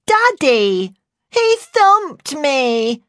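loud, high, drawn-out cry near tears; child's wail. A narrator's reading. Jodie naming her father to her carer, loud tearful wail. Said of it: "she wailed".

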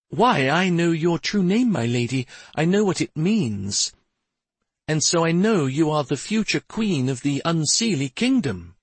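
high, chiming fairy voice; knowing fairy attendant. Acted. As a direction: address your future queen as a high-voiced fairy.

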